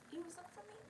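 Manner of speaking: high, soft playful question; teasing whisper-high. Real, not acted. Host teasing viewers who missed her, high and soft. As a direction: tease them coyly.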